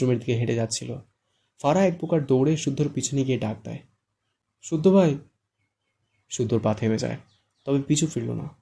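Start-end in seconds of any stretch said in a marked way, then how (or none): 4.6-5.2 calling out. A narrator's reading. Fara calls after Shuddho.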